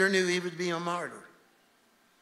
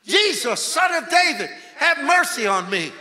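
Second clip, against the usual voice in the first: high, loud desperate cry for mercy; shouted plea, pitched high; yelling; 9 semitones higher, 9 dB louder than usual. Acted. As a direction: yell it out to be heard over a crowd. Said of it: "he starts yelling".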